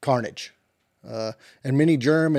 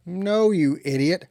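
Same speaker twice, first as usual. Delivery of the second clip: slow, higher, exasperated put-down; 5 semitones higher, slower than usual. Acted. Cowboy scolding his slow-witted interviewer, exasperated. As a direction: draw out the scornful rebuke.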